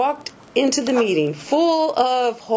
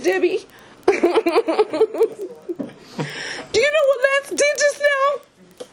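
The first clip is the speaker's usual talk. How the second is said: upset tearful; 12 semitones higher than usual. Retold or imitated. Calling sponsor upset.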